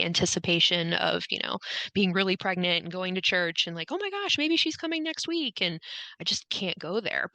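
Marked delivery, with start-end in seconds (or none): 3.9-5.6 excited gushing. Retold or imitated. Imagined excited churchgoers last year.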